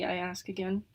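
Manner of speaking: ordinary delivery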